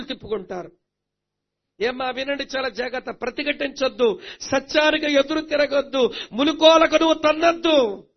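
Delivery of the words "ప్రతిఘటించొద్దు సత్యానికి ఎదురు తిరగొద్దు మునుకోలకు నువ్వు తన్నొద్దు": building louder and higher with each warning; urgent warning. Real, not acted. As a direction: press each prohibition harder than the last.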